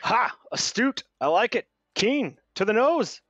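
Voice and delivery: loud, high, bursting compliments; overjoyed swaggering bard; delighted exclamations. Acted. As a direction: fire each word off with glee. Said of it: "GM voicing eager NPC".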